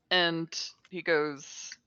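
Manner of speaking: ordinary talk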